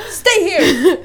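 loud, high angry command; imitated furious staffer. Traveller mimicking staffer ordering them like children, imitated angry bark. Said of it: "Det var liksom så här skitarg".

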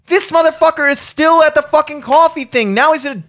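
loud, very high mock outrage; outraged. Finding the priest still at coffee, outraged. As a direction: rant in squeaky disbelief. Walks in, finds him still there.

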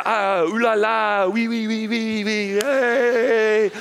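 hammed-up French cheer, ooh la la; a cheering Frenchman in a silly mock French accent. French soldiers celebrating their wall, in mock French accent.

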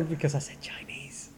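hushed whisper, voice dropped to breath. Real, not acted. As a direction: whisper it like a guilty secret. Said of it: "Why are you whispering?".

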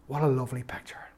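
soft wondering whisper; awed whisper. Real, not acted. Preacher marvelling at the father's embrace, whispered. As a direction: hush it in awe.

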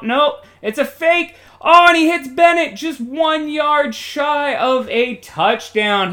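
surprised sportscaster burst; excited play-by-play. Pretend. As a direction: jump on the fake as it unfolds. Live call of fake and catch.

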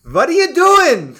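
high, loud, slow mock Yiddish accent. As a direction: exclaim in a hammed-up Yiddish accent.

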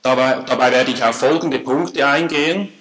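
normal talking voice

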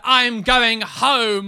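loud, slow mock sulky announcement; imitating boyfriend. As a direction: play him storming off. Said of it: "He was like".

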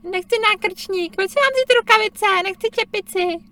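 put-on a child refusing every piece of clothing; imitating a sulky toddler; whiny child.

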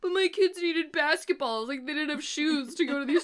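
high, slowed mock-plea voice; mock pleading. Host mimicking colleges begging for their cash, mock pleading. Mocking imagined college pleas.

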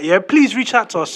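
ordinary talk

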